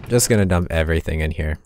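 ordinary talk

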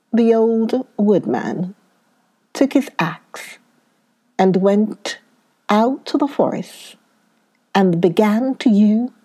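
plain reading voice

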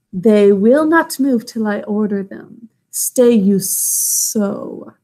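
slow hissing python voice; hissing snake. Acted. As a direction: draw the words out like a snake. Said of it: "Kaa hissed".

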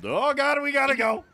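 high panicked yelp. Real, not acted. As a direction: blurt it out in a panic. Panic during chase.

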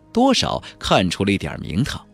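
ordinary delivery

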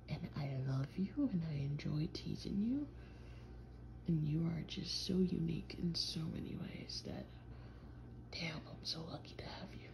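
soft, sincere whisper; hushed, affectionate whisper; whispered tenderness. Acted. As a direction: murmur your love close to her ear.

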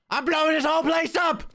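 high-pitched playful yell; mock-threatening yell. Player boasting to chat of setting bombs, high mock yell. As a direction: holler it high, like a pretend bomber.

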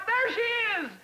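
over-the-top enthusiastic yell; excited shout. Pretend. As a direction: burst out cheering for the truck. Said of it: "I want to shout".

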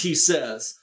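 ordinary talk

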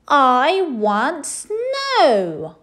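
high, loud, very slow drawn-out whine; whiny demand. A narrator's reading. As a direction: stretch the refrain out.

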